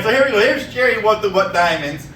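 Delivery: ordinary delivery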